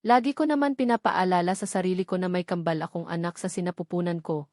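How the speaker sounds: usual speaking voice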